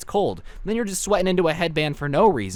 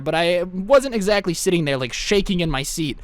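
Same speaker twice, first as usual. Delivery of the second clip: ordinary talk, nothing marked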